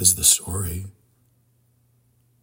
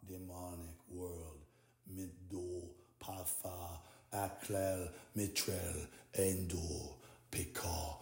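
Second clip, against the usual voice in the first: barely audible murmur; 2 semitones lower, 21 dB quieter, slower than usual. Acted. Addressing the demonic world, faint murmured incantation. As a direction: murmur it under your breath.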